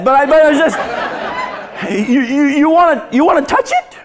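laughing through a high dare; laughing high mock dare. Real, not acted. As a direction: giggle as you dare him. Laughing aside retelling dare.

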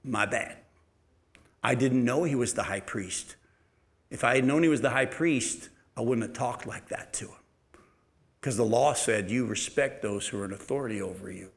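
a lightly voiced humble Paul owning his mistake; humbled apology. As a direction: say it softly, humbling yourself. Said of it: "Paul humbled himself and said".

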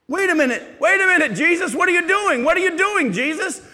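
loud, high, incredulous protest; hammed-up disbelief at Jesus; mock astonishment. Preacher pretending to question Jesus, in loud mock disbelief.

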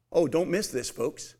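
ordinary talk

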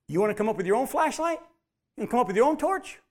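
quick, rising, mock-challenging; God chiding the self-reliant; teasing challenge. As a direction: needle them a little. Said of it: "And he says".

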